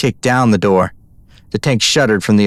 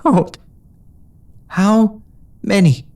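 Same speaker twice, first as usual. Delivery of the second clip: tight throat; 10 semitones higher, much slower than usual. A narrator's reading. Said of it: "Her throat pinched shut".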